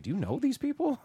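high-pitched imitation of a puzzled wife; confused wife. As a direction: ask it high, as a confused partner. Retold confused wife's question.

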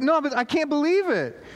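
mock kid still in disbelief; amazed teen. Teen's amazed reply.

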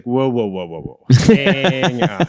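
playful stop-right-there; mock whoa, hang on. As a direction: pull someone up short, half joking. Mock stopping a hasty judge.

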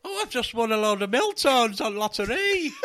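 high, slow Yorkshire lottery winner; broad Yorkshire accent, delighted. Pretend. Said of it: "That was a Yorkshire accent".

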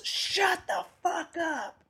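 slow, high, held-back holler; mock yell. Comic imitates the audience guy yelling at two whispering comics, slow mock yell. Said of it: "And he yells".

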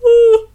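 loud open-mouthed yawn; big yawn. Real, not acted. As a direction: let out a long yawn. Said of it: "excuse me while I yawn".

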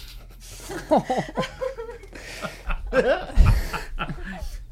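long, loud, high-pitched guffaw; real laugh. Real, not acted. Musician laughs about being seen as old guys, loud guffaw. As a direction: build into a big high cackling laugh.

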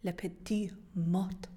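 Le Petit Mot said slowly in French; slow, careful French Le Petit Mot; French pronunciation. Shown as a demonstration. Correcting the co-host, slow careful French Le Petit Mot. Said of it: "The little hill?".

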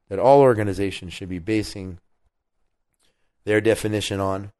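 normal talking voice

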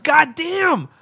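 high, loud exclamation of delight; real burst of excitement; excited exclamation. Real, not acted. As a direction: blurt it out, thrilled. Reacting to bat hit.

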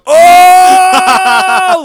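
long, high, loud "oh" across a party; loud jeering holler. Retold or imitated. Buddy pointing out the culprit to the crowd, loud mock holler. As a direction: holler it out high and drawn-out, imitating a friend. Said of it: "he points and goes".

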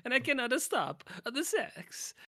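put-on high, hammy Italian accent; mock Italian accent. Friend joining the bit, mock Italian accent. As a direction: play the randy Italian for laughs. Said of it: "I'm Italian".

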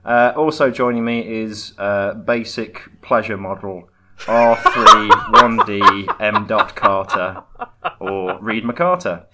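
loud, mock-robotic show host intro; announcer voice.

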